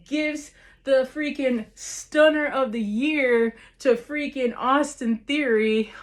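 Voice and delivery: ordinary talk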